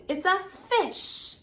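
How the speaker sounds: ordinary talk, nothing marked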